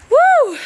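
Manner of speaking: high, loud woo. Real, not acted. Whooping at the audience as she takes the stage. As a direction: whoop to the crowd. Crowd cheering.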